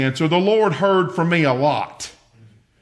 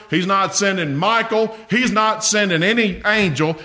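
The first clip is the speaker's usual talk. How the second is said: rising preaching; 4 semitones higher than usual. Acted. Builds to preaching climax.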